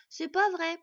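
high, loud indignant little voice; protesting child. A narrator's reading. Boy contradicting the dwarf, high loud protest. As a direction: protest hotly like a small boy. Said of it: "protesta le petit garçon".

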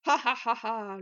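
hearty acted guffaw; loud belly laugh. A narrator's reading. Smith slapping his thigh, roaring with laughter. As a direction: laugh heartily at full throat. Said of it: "rit à gorge déployée".

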